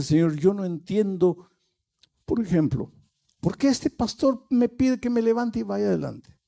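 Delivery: a listener's mock bewildered complaint; puzzled objection. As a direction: voice a doubter who doesn't get it.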